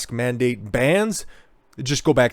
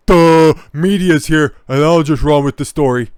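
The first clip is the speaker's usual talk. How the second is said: mocking voice; 3 semitones higher, 8 dB louder than usual. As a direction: drag it out, mocking their press stunt. Mocking doctors for media.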